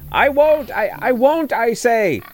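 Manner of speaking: shouting as loud as he can; loud cry. A narrator's reading. Shoemaker tied in a sack crying out to the swineherd, full shout. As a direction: cry out at the top of his lungs. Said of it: "he cried out as loud as he could".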